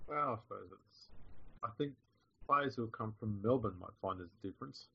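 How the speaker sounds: faint, far-off voice; quiet mic. Real, not acted. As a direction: sound distant from the mic. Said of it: "You sound very quiet".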